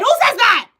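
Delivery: high, loud and delighted; delighted excitement. Real, not acted. Host reacting to a viewer's odd wish, loud delight. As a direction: revel in how funny the wish was.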